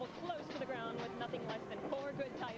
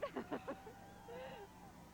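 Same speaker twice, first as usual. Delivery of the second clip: quiet real laugh; soft laugh; 9 semitones higher, 7 dB quieter than usual. Real, not acted. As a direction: laugh softly, pleased. Laughs on receiving gift.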